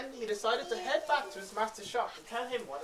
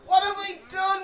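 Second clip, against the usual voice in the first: higher, louder despairing cry; anguished cry; 8 semitones higher, 11 dB louder than usual. Acted. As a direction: cry out, holding back tears. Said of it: "he cried".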